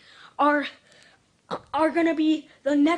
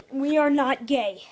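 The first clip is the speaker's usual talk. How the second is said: normal talking voice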